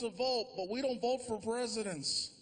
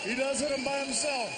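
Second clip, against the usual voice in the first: higher and louder punch; emphatic repeat; 5 semitones higher, 4 dB louder than usual. Real, not acted. Comedian repeating the president joke, louder. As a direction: hit it harder the second time.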